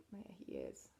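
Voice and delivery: ordinary delivery, nothing marked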